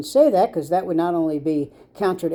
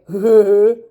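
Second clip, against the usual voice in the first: short loud laugh; 8 dB louder than usual. Real, not acted. Laughs at own joke.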